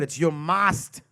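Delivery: put-on slow German-accent "must". Comedian mocking German bluntness, German accent. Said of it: "always with the Germans".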